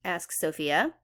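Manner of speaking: usual speaking voice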